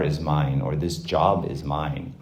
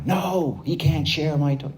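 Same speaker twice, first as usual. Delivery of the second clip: put-on whiny child; 9 semitones higher than usual. Child refusing to share his toy with a sibling, high and whiny. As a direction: protest like a toddler guarding his toy.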